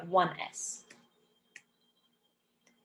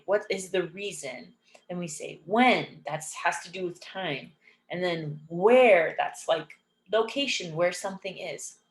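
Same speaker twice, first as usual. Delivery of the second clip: ordinary talk, nothing marked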